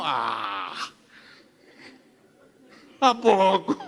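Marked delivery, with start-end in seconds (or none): 0.0-0.7 low, soft feeble ah; a deflated, half-hearted grunt; limp little ah. Pretend. Mocking a feeble shout at the walls, low and limp. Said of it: "¿Así le dijo?".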